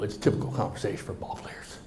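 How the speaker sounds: soft unvoiced throwaway; muttered aside. Shown as a demonstration. Speaker to audience, muttering the aside about ball players. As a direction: mumble it under your breath. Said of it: "Did you hear that?".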